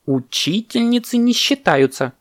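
high, emphatic protest; parrot's outcry. Acted. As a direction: cry it in an indignant high pitch. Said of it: "закричал попугай".

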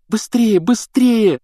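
high, pressing plea; urgent pleading. A narrator's reading. Hermione begging Harry to hurry, high and pleading. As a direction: plead for haste, pitched high. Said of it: "взмолилась Гермиона".

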